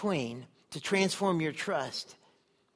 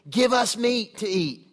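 higher, louder, slow mocking whine; whiny complaining crowd; 6 semitones higher, 8 dB louder, slower than usual. Preacher mimics the Israelites wailing for meat, mock whine. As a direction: drag it out like a whining crowd.

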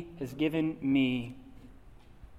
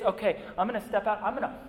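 fading from firm resolve to a hushed, softer prayer; sincere surrender; 6 dB quieter than usual. Retold or imitated. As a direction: give yourself over, quieter with each phrase.

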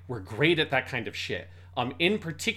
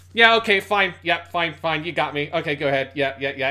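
imitating a player conceding, loud at first; quick, repetitive giving up; mock-exasperated surrender; resigned losing gamer; 2 semitones higher, 6 dB louder than usual. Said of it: "realizes he's definitely losing".

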